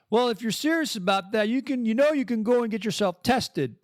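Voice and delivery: high-pitched quoted advice; imitating her remark. Quoting a woman's remark.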